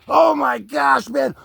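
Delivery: loud, high, drawn-out shock; startled. Real, not acted. Host jolted by what he sees, startled cry. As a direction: cry out as if badly scared. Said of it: "that just scared the snot out of me".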